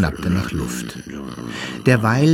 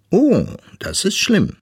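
high walrus voice with a drawn 'Oh'; weises Walross, betroffen; 10 semitones higher than usual. A narrator's reading. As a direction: react as a concerned walrus, pitched high. Walrus figure reacts to the news. (hohe, betroffene Walrossstimme)

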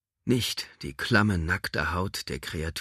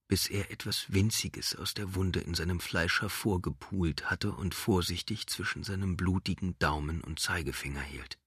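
ordinary delivery, nothing marked

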